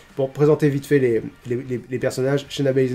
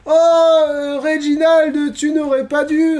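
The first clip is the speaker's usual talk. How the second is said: Nia Jax; 12 semitones higher, slower than usual. Acted. Nia Jax thanks Reginald for flowers, hammed-up high gush. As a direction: play a hammed-up flattered recipient of flowers. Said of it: "Évidemment, Nia Jax dit".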